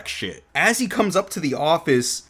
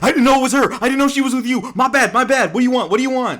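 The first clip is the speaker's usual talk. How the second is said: high-pitched, rapid-fire pleading; mock panic; 10 semitones higher, 4 dB louder, faster than usual. Boss begging Ryo for forgiveness, comically panicked. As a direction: babble apologies like a cornered boss.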